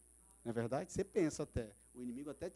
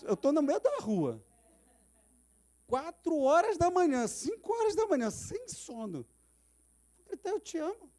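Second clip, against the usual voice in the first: higher, louder, slowed objection; incredulous protest; 10 semitones higher, 7 dB louder than usual. Retold or imitated. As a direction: argue back in disbelief. Man protesting to God.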